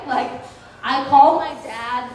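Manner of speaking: ordinary talk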